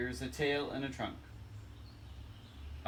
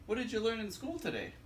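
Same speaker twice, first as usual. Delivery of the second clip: put-on higher, sing-song babysitter; 9 semitones higher, faster than usual. Babysitter asking little critter about school, higher sing-song. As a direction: lift up like a friendly sitter asking.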